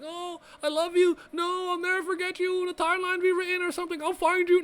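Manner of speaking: high, hammed-up lovers' farewell; mock the tearful movie goodbye in a high voice; fake-deep melodrama. Mocking a movie's fake-deep love scene, high mock melodrama. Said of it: "fake deep".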